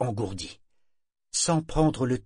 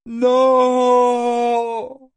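piercing, much higher and louder shriek; shrill scream; 11 dB louder than usual. A narrator's reading. Parangon screams his refusal into the night. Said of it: "cria Parangon d'un ton suraigu".

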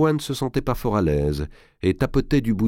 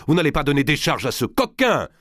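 high-pitched outraged cry; the uncle crying out against the pardon; exclaiming; 9 semitones higher than usual. A narrator's reading. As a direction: protest sharply, much higher. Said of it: "s'écria-t-il en s'élançant".

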